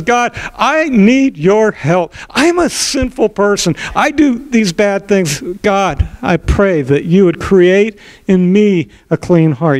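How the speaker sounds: high, slow plea for help; earnest prayer. Acted. David admitting sin before God, high slow plea.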